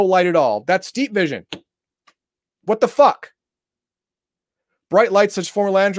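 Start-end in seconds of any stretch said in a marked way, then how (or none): 2.6-3.3 exasperated outburst. Real, not acted. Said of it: "I'm pissed off".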